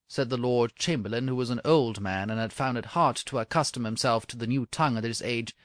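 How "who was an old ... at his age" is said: plain reading voice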